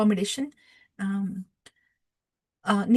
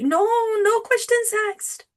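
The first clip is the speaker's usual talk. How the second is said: emphatic denial; 12 semitones higher, 4 dB louder than usual. Acted. Speaker rules out any doubt in the devotee, emphatic. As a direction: dismiss the very idea, pitched far up.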